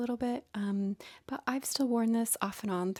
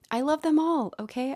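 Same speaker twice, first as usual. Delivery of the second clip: excited outburst; 7 semitones higher, 8 dB louder than usual. Real, not acted. Gushing over her collection.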